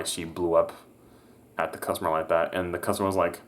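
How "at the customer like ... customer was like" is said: ordinary delivery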